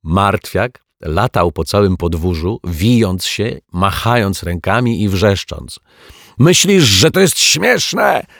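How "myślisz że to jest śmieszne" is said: high, loud, slow enraged yell; furious shout. Acted. The soaked lodger shouting at the detective, furious. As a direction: bellow it at the laughing detective. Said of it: "krzyknął z wściekłością".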